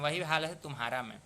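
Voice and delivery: ordinary delivery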